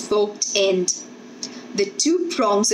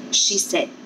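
plain reading voice

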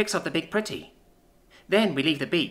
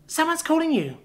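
put-on higher, childlike little girl voice; little sister; 13 semitones higher than usual. Little sister answering her big sister about Earth, higher childlike voice. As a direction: voice a young child, pitched well up. Child character speaks in story.

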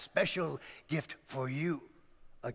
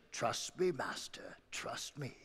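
soft, hushed trust me; calm the master gently, almost whispering; quiet reassurance; 3 semitones lower, 8 dB quieter, slower than usual. Acted. Ox reassures farmer.

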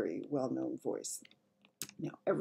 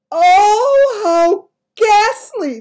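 very high, loud, drawn-out wail; horrified cry; 19 dB louder, much slower than usual. Acted. Queen reading of vanished children, horrified cry. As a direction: cry out slowly in horror. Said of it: "the famous voice cried out".

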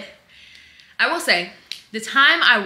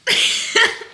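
sheepish laugh; 12 semitones higher, 5 dB louder than usual. Real, not acted. Laughing at own failed fast.

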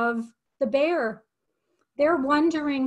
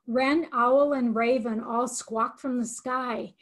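usual speaking voice

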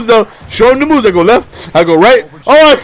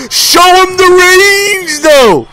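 hyped drawn-out call; 9 semitones higher, much slower than usual. Real, not acted. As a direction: sing it out like a hype man. Hyping his own shot.

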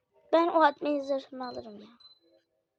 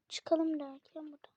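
whispered aside; 8 dB quieter than usual. Real, not acted. Aside while playing.

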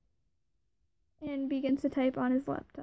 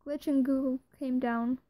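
ordinary delivery, nothing marked

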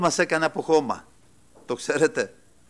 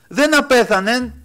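solemn declaration; 8 semitones higher, 7 dB louder, slower than usual. Acted. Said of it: "λέει ο Κύριος".

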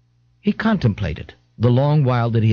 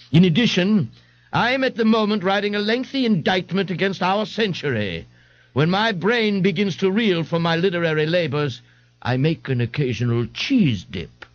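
Ignatius pompous; 8 semitones higher than usual. Acted. Ignatius explains himself.

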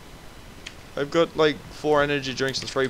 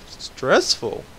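ordinary talk, nothing marked

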